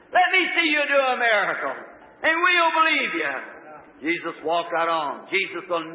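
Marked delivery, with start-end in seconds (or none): 0.1-3.3 challenging demand. Acted. Mocker demands a miracle.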